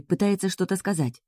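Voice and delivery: ordinary delivery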